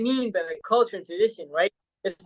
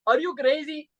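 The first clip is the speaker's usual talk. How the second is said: higher-pitched imitation Arabic-accented English; 6 semitones higher than usual. Friend scolding him for laughing at an elder, imitated Arabic accent. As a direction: put on a rough Arabic accent. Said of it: "he even said in English with this, like, Arabic".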